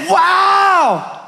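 big high drawn-out exclamation; amazed. Retold or imitated. Dad on hearing daughter's trust, amazed. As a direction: cry out high in wonder.

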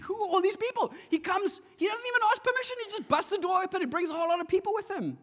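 very high, rushed, incredulous squeal; comically outraged at uninvited guests; mock indignation. Acts out indignant new believer.

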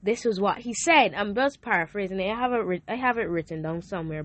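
ordinary talk, nothing marked

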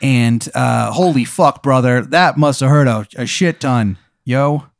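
a surfer dude playing doctor; surfer bro doctor. Doctor Daniel Dude greeting his patient, bro voice. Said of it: "He goes".

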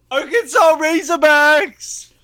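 high, slow, loud reveal; excited announcement. Real, not acted. Fan reveals his pick, drawn out and loud.

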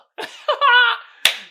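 big shrieking burst of laughter; loud high laugh. Real, not acted. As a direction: crack up loudly. Laughing at own impression.

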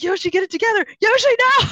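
high scolding building to a loud yell; frantic scolding. Acted. Player to Yoshi on screen, frantic yelling. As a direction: shriek at your character as it blunders.

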